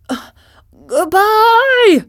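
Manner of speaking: high, loud calling goodbye. Acted. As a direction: call out across the water. Scarecrow calling after raft.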